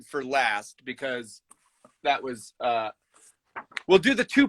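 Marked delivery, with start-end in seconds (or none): none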